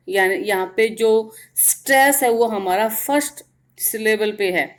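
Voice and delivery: ordinary talk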